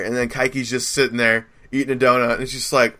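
normal talking voice

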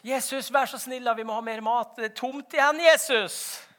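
high, loud, urgent begging; acted disciples clamouring for help; pleading call. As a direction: call out needily to the master. Disciples pleading to Jesus again.

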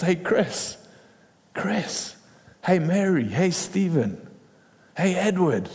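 acted calling-out, name after name. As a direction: call each person over by name. God calling people by name.

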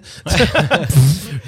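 short burst of amused laughter. Real, not acted. Quiz host amused by the guesses, laughing. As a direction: break into a quick laugh.

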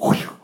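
a quick unvoiced whoosh for running off; breathy whoosh. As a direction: blow out air like someone bolting away. Runaway escaping down stairs.

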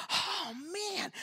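high, soft, defeated "oh, man"; weary groan of a line; deflated sigh. Retold or imitated. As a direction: sag under the weight. Feeling spiritual oppression.

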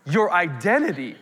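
high, loud, fervent pulpit preaching; preaching at full force. Acted. Pastor rousing the congregation about the Holy Spirit, full-force preaching.

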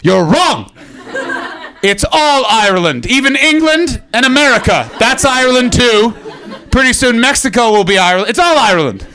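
loud mock-indignant declaring; mock uncalm rant. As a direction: argue it hotly, as a hammed-up zealot. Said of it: "calm tone while people from the Republic".

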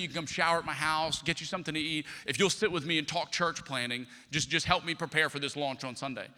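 usual speaking voice

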